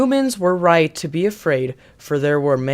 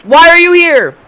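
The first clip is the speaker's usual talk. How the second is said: incredulous shout; 12 semitones higher, 14 dB louder than usual. Pretend. Mocking the host.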